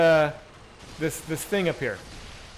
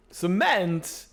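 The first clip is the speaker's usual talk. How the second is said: rough celebrity impression, high and halting; Christopher Walken; 7 semitones higher, 4 dB louder, much slower than usual. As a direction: attempt a Walken, drawn out with odd pauses. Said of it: "my Hawkins, no, no fucking, no walk in the park".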